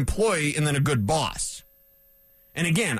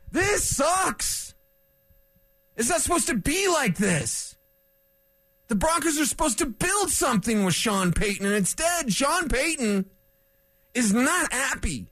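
frustrated rant, much higher; pissed off emotional; 8 semitones higher than usual. Real, not acted. Host venting to listeners about the Broncos, pitched-up frustrated rant. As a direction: vent real frustration, pitched up. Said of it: "pissed off emotional".

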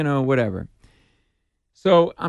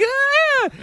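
fangirl scream; 3 dB louder than usual. Retold or imitated.

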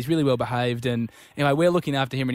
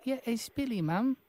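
high, quiet put-on child voice; little boy Billy; 8 semitones higher, 9 dB quieter than usual. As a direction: play a small English boy, soft and pitched up. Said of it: "Billy? Bill-, Billy".

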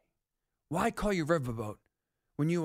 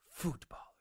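whisper; 4 semitones lower, 10 dB quieter than usual. Acted. Mocking the Brits' word.

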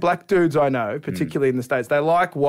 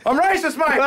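much higher, proudly blurted confession; dad, proud; 11 semitones higher than usual. Retold or imitated. Proud dad blurts his confession to the New York comic. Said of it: "My dad".

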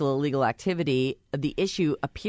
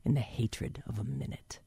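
whisper; 8 dB quieter than usual. Shown as a demonstration. Rereads the line, drops to whisper.

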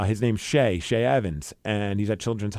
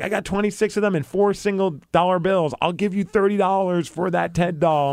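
lightly imitated child, higher; eager kid; 6 semitones higher than usual. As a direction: mimic a kid making an excited offer. Imitates kid bargaining for doll.

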